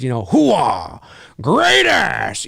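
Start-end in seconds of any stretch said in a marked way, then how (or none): 0.2-1.0 gruff Pacino-style hooah; a quick Pacino hooah; Pacino catchphrase. Retold or imitated. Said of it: "I can do catchphrases". 1.4-2.5 Pacino line. Retold or imitated. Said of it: "something he says in Heat".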